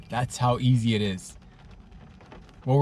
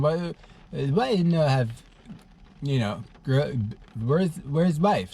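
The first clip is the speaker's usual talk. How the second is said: put-on Eastern European accent. Neighbor asking why he is single, halting Eastern European accent. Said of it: "with her beautiful Eastern European accent".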